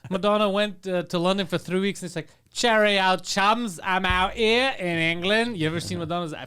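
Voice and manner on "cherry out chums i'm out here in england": fake English accent. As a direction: put on a badly faked English accent, drawing out the end. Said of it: "fake accent".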